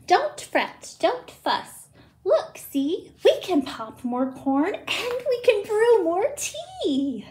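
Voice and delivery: squeaky mouse. Acted. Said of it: "Mouse squeaks".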